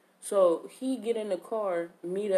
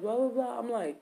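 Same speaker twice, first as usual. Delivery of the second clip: ordinary delivery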